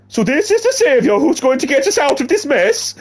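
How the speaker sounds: high, loud put-on Canadian voice; attempted Canadian accent. Reading a character line in a mock Canadian accent. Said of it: "Was that Welsh?".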